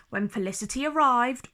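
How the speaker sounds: ordinary delivery, nothing marked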